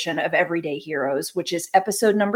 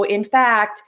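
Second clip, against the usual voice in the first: usual speaking voice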